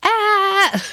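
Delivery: high mock shriek into a laugh; playful fake scream at the imagined dog. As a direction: shriek then crack up laughing. Imagined Doberman bursting out.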